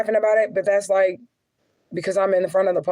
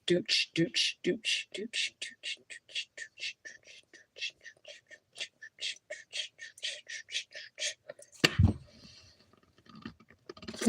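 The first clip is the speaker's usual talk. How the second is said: soft rhythmic dooch sounds fading into a whisper; beatbox-style dooch trailing off to a whisper; whispered beat noise; 14 dB quieter, much slower than usual. Acted.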